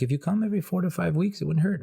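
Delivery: high, fast imitation of the waxer; waxer's voice impression. Mocking the waxer's line, a high fast impression. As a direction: squeak it out like the waxer. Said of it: "She's like".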